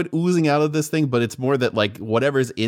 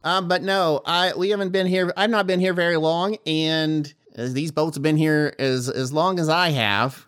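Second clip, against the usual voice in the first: the GM's fish answering the diver, a bit higher and southern; lightly southern fish voice; 5 semitones higher than usual. Acted. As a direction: keep the flounder's folksy Southern voice going. GM still voicing the fish.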